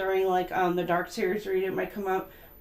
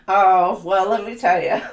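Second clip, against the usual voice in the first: much louder, laughing through it; laughing confession; 9 dB louder than usual. Real, not acted. The host admitting she judges too, laughing loudly. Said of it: "laughing admission".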